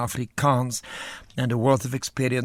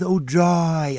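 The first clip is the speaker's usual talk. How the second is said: mock joyful cry; 5 semitones higher, 4 dB louder than usual. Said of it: "flipping all over the place with".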